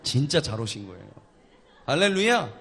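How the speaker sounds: ordinary talk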